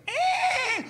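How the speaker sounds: high laughing yelp; hammed-up whinny; mock horse whinny. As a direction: squeal like a rearing horse. Horse rearing.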